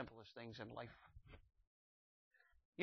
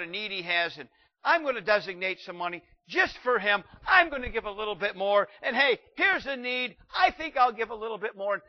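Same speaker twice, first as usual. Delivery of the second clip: higher, louder eager resolve; eager giver; 6 semitones higher, 7 dB louder than usual. Retold or imitated. Church member planning a spending spree for God, eager. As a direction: gush about giving more and more. Imagined generous giver speaking.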